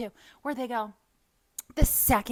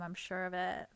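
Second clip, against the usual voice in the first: usual speaking voice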